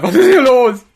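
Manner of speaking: mock shouting voice. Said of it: "Und dann kommt Harald".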